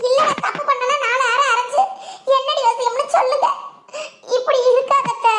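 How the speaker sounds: upset pleading. Acted. Daughter-in-law begging her aunt to ask openly, upset pleading. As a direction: plead with her to speak straight out.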